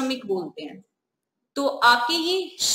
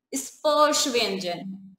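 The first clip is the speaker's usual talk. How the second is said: slow clear term; 2 semitones lower, much slower than usual. Shown as a demonstration. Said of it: "कह दिया कि ये क्या है?".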